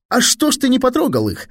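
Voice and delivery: high-pitched goading question. A narrator's reading. Sherman trying to needle his pal, goading. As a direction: needle him in a high boyish voice. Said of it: "чтобы хоть как-то подначить приятеля".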